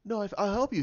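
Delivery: high-pitched imitated protest; pleading heroine. Rey insisting on helping Luke, high pleading imitation.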